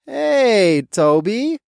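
forced nice greeting; nicest tone. A narrator's reading. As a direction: sound as nice as possible. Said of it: "in the nicest tone possible".

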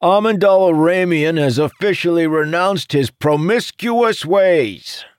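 high, loud public proclamation; shouted announcement. A narrator's reading. Jacob announcing his friend's reform to the crowd, shouting. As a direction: proclaim it to the whole room. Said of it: "turned to the shouting necromancer".

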